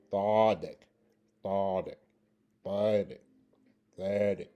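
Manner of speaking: "thodek" repeated with pauses, th-sound first; alternative pronunciation. Shown as a demonstration. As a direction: say "thodek" with th in place of z, each alone. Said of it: "you can also pronounce it".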